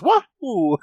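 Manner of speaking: high cartoon plumber whoop; Mario cheer. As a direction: whoop like a video game plumber.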